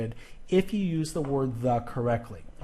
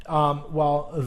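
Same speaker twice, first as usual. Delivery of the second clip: normal talking voice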